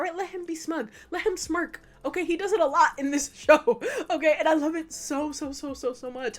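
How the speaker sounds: words broken by laughter; real amused laughter; laughing. Real, not acted. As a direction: laugh while gushing. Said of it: "laughing while talking".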